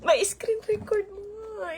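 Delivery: hushed urgent whisper; nervous whisper. Real, not acted. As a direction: whisper it so the stream won't hear. Urgent aside off mic.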